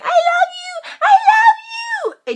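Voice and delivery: very high, loud mock squeal; mock lovey-dovey couples in a piercing squeal; gushing lovebirds. Mimics couples in the hallway.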